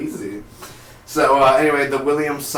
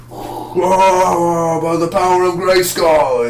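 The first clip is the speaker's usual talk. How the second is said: loud, higher heroic yell; mock battle cry; 7 semitones higher, 5 dB louder, much slower than usual. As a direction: bellow it like a hammed-up superhero. Acts out Hawkman's battle cry.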